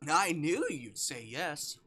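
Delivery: slow Verdi voice. Acted. Voicing Verdi's pleased reply.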